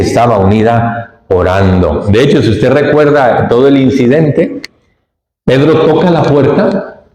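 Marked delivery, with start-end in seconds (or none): none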